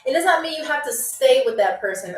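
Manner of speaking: usual speaking voice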